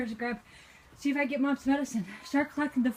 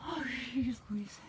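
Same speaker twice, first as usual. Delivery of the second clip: whispering; 3 semitones lower, 6 dB quieter than usual. Real, not acted. Whispered in shock.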